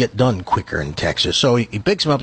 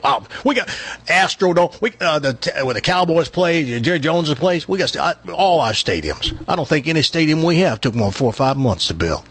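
higher-pitched boastful Texan; bragging Texan; 4 semitones higher than usual. Acted. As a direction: boast in a put-on Texas accent. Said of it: "one of these Texans that brags all the time".

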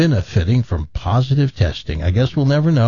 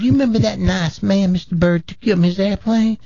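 put-on Southern accent; 4 semitones higher than usual. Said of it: "with a thick Southern accent".

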